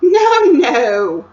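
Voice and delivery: playful refusal; Queen's voice. Acted.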